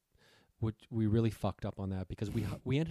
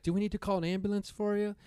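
high, anxious voice offering help; fretfully offering to call an ambulance; Megan worried; 10 semitones higher than usual. Retold or imitated. Megan asks the hurt girl if she needs an ambulance, high and worried.

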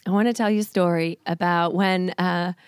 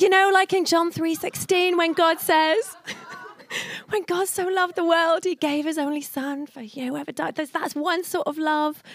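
higher mock of a nervous boyfriend earnestly explaining; hammed-up awkward suitor, pitched up; imitating Ben; 8 semitones higher than usual. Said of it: "And he's like".

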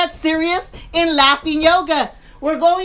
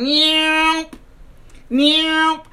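a higher, playful mock meow; cat meow; 4 semitones higher than usual. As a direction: meow like a cat at the string-pull exercise. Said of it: "I was going".

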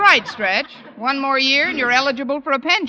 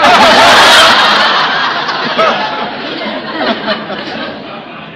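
laugh; 3 semitones higher, 8 dB louder than usual. Real, not acted. [name] laughs off the compliment.

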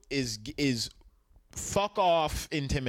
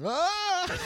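yelp into laugh; 11 semitones higher than usual. Real, not acted. Reacting to Benjamin Button joke.

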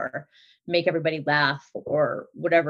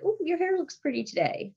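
sweet compliment; 10 semitones higher, 4 dB quieter than usual. Retold or imitated. Complimenting daughters.

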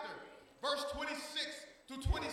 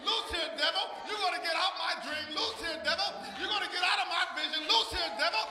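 preacher's chanted command, building to a high shout; rebuking the devil; 4 semitones higher, 4 dB louder than usual. Real, not acted. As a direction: cast the devil out with mounting force.

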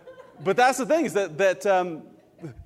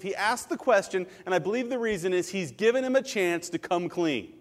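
ordinary talk, nothing marked

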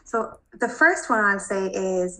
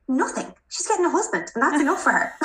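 put-on sassy kid; 7 semitones higher than usual. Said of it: "she had such an attitude".

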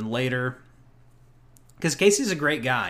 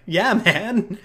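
laughing; 7 semitones higher, 5 dB louder than usual. Retold or imitated. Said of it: "And I was like".

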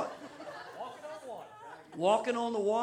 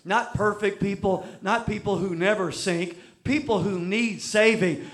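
usual speaking voice

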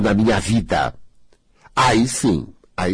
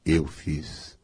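stunned admission; 12 semitones lower, 9 dB quieter, much slower than usual. Retold or imitated. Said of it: "E a pessoa ouve aquilo".

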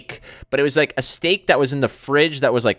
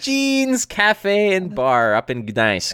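slow, higher-pitched announcer read; sponsor announcement; 7 semitones higher, slower than usual. Real, not acted. Host plugging the sponsor cafe to listeners, announcer style. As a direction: draw out the sponsor's name with announcer flourish.

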